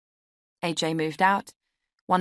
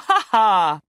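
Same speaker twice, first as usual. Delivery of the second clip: loud, spelled-out fake ha ha; hammed-up laugh at her own joke; forced laugh; 4 semitones higher, 10 dB louder than usual. The letter-writer laughing at her own joke, forced.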